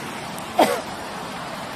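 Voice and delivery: hacking cough from the gas; coughing fit. Real, not acted. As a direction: cough through stinging smoke. Tear gas in the air.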